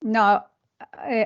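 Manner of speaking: normal talking voice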